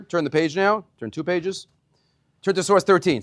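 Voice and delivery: usual speaking voice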